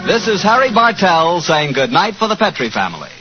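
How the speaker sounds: ordinary talk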